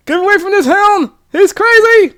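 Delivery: high, loud frantic shriek; panicked yell. Pretend. Jim Carrey escaping the crazy hound, frantic high yell. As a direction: scream it over your shoulder in a panic.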